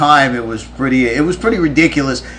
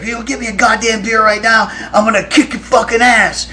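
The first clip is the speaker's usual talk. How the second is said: imitating a threatening yell, much higher and fast; yelling threat; 9 semitones higher than usual. Drunk bandmate threatening the friend who won't hand him a beer, mock yelled threat. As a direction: rattle off the threat like a drunk about to fight. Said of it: "he's yelling at Ben".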